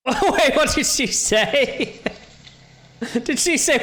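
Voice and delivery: laughing disbelief. Real, not acted.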